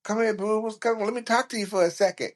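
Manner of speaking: high sweet flirty voice. Retold or imitated. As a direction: coax him over sweetly. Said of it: "the little sweet voice".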